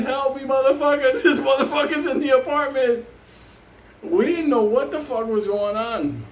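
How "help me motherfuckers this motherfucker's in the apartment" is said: higher-pitched mock scream for help; retold screaming. Trapped friend calls downstairs to his crew for help, mock screaming. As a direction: cry out as the trapped friend, half-strength. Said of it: "he's screaming".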